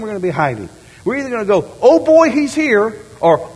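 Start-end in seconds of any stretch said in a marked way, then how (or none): 1.8-2.9 high, loud glad exclamation; joyful oh boy, he's here; delighted cry. Pretend. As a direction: greet him with happy surprise. Eager believer greeting Christ.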